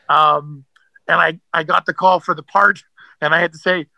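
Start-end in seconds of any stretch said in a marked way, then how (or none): none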